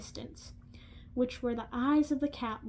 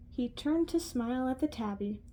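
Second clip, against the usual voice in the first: ordinary delivery, nothing marked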